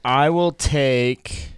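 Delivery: drawn-out suspense; dramatic slow. Acted. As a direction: stretch each word slowly. Suspenseful draft pick.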